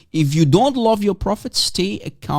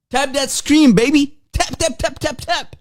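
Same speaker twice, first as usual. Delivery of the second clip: higher, sing-song tap tap tap; hyped-up urging; 9 semitones higher than usual. Acted. Streamer urging viewers to tap the screen, high sing-song hype. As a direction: egg the viewers on, playfully.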